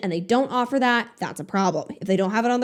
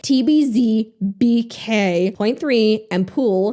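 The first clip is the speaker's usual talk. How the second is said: ordinary delivery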